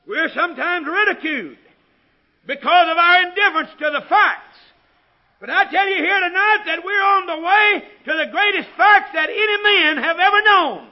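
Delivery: loud, high preacher's proclamation; ringing pulpit declaration at full volume; full-force preaching. Real, not acted.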